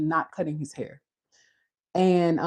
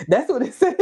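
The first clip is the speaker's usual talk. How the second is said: laughing through the words; 6 semitones higher, faster than usual. Real, not acted. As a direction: chuckle it out. Laughing at own joke.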